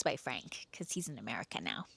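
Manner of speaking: conspiratorial whispered aside. Real, not acted. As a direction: drop to a whisper for the joke. Side joke about his name.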